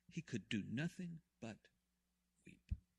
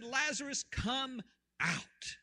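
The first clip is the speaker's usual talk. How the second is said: much higher, louder commanding call; shout; 12 semitones higher, 6 dB louder, much slower than usual. Acted. Jesus shouts into the tomb, a commanding call. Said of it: "Jesus shouted".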